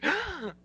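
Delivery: high, sharp hammed-up gasp; mock gasp. Co-host hams up horror at browser buttons with a gasp.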